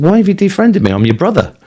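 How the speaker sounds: mock-wounded appeal, pitch jumping up; retold indignant protest. Speaker asks brother why he cut him off, retold indignant protest.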